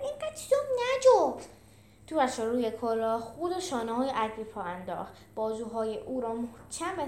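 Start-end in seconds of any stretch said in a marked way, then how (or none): 0.0-1.4 high, loud, whiny complaint; exasperated high squawk; grumbling. A narrator's reading. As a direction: grumble in a high-pitched character voice. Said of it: "پنی قرقر کرد".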